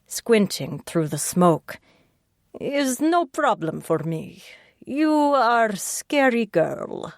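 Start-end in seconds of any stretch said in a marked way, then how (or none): 2.6-7.1 put-on slow Russian accent, higher; thick Russian accent, amused. A narrator's reading. Russian smoker teasing the detective in a thick Russian accent. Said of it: "His Russian accent grew stronger".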